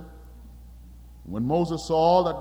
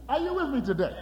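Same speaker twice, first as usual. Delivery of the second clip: ordinary talk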